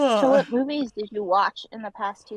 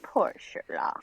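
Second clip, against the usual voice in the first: whispered pity; 4 dB quieter, slower than usual. Real, not acted. Pitying the cat.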